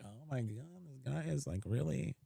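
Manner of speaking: inner exasperation. Retold or imitated. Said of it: "And I'm like".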